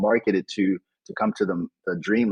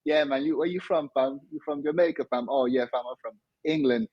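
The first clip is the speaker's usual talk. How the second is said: high, softer London youth slang voice; imitated London crew talk, pitched up; London street accent; 5 semitones higher, 3 dB quieter than usual. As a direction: chat like a London kid among mates, in London accent. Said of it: "I was in England like".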